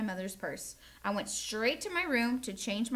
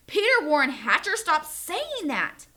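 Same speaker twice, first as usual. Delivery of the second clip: louder and higher, slow stern full-name scolding; hollering; 5 semitones higher, 8 dB louder than usual. Acted. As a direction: Mom scolds Peter by his full name. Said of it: "Mom hollered".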